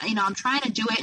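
ordinary talk, nothing marked

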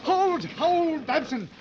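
high, loud yelled orders; desperate shouting. Acted. Guide yells at panicking hunter, desperate shout. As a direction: scream at him to hold his ground.

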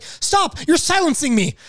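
high, mocking indignant protest; indignant girl. Girl protesting being silenced, hammed-up high voice. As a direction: play her as offended. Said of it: "She's like".

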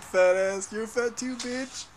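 high mock yell; quoting the fat kids video. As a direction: bark it like the kid in the video.

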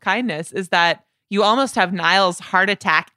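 ordinary talk, nothing marked

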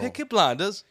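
imitation English accent, punched out; Peaky Blinders accent. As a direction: put on a British gangster accent. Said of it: "that little fucking accent".

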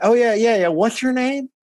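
high-pitched, imitated southern phone clerk; southern lady. Southern lady answering a warrant call, imitated in a high voice. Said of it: "this real southern lady picks up".

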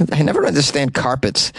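normal talking voice

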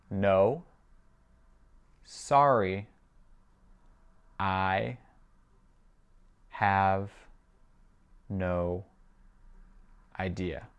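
very slow, each word on its own; spaced-out language-drill reading. Shown as a demonstration. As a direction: leave a long gap after every word. Teacher repeats it word by word.

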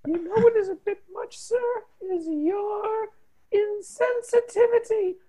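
put-on high, crying child voice; whiny child. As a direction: wail it like a pitiful sick kid. Melodramatic sick child pleading.

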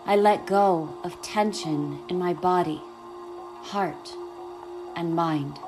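calm, unhurried guiding voice; guided meditation. Acted.

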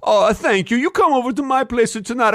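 high, hammed-up Italian accent; mock Italian cook gushing an invitation; Italian chef voice. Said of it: "And then he goes".

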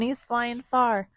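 usual speaking voice

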